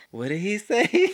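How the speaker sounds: slow, high, puzzled question; baffled. Retold or imitated. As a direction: ask as if you understood nothing. Acted bafflement at Southern speech.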